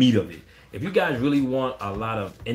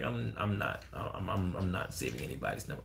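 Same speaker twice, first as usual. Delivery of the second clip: much lower and softer, flat no; deadpan refusal; 5 semitones lower, 11 dB quieter than usual. Retold or imitated. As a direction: quietly shut them down. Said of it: "I'm like".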